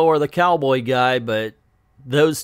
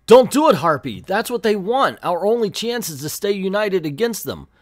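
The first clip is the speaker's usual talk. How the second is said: urgent plea; 7 semitones higher, 4 dB louder than usual. Retold or imitated. Callista pleads with Harpy.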